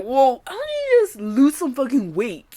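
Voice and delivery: exaggerated clueless question, voice pushed up; mock critic. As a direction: mimic a judgmental stranger, slowly. Imitating fatphobic viewers' question.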